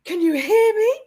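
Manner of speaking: high, loud call into the void. Shown as a demonstration. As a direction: shout it out as if through a spirit box. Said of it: "Like this".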